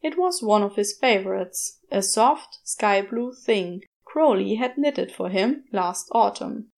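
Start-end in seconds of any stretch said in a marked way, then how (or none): none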